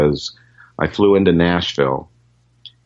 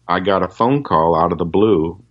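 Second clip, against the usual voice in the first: ordinary talk, nothing marked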